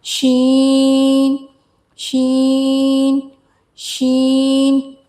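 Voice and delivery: शीन slow with a spread sh; spreading sheen. Shown as a demonstration. Teacher modelling a spreading शीन slowly. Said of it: "इसको पढ़ना है".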